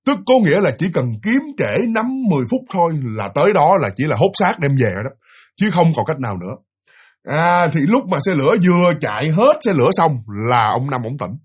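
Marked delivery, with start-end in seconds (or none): none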